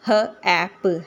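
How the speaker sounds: ह and ऐप said as separate sounds; sounded out. Shown as a demonstration. Teacher building the first syllable of happy, separated sounds. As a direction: break हैप into ह, ऐप. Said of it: "one syllable".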